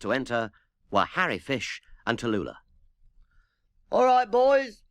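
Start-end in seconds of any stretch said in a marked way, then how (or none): 3.9-4.8 high, louder call to the band; shouted. Acted. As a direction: bellow across the studio to settle them. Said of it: "shouted Harry Fish".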